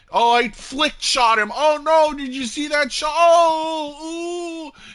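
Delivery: very high, loud hype voice; mock amazement. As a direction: imitate a player wowed by their own shot, drawing out the oohs. Mimics gloating cheater after shot.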